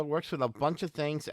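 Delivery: ordinary talk, nothing marked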